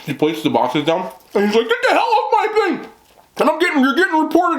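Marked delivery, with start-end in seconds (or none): 1.7-4.6 high-pitched outraged holler; imitated yelling. Homeowner chasing off delivery lady, imitated angry yell. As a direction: act out yelling someone off the property. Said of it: "I'm sorry I'm yelling".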